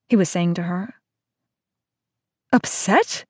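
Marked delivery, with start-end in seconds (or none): 2.5-3.2 sputtering disbelief. Acted. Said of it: "she sputtered".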